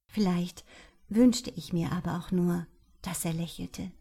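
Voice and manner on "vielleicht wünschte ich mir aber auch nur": usual speaking voice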